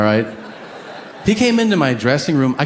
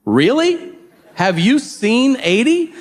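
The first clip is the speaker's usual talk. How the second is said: pitched way up, stretched in disbelief; incredulous; 9 semitones higher, slower than usual. Real, not acted. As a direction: scoff at the idea in disbelief. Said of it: "incredulous reaction".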